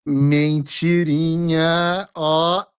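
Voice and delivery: long, drawn-out mocking call; sing-song taunt. Real, not acted. Host calling out the president's little lie, drawn-out taunt. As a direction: stretch it out teasingly. Taunts a politician after his clip.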